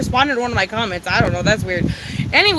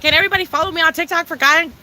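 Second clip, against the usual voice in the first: normal talking voice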